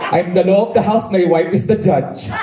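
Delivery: slow grand line, deflated ending; slow mock pronouncement. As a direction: declaim slowly, then let it fall flat. Repeating father's advice, undercut.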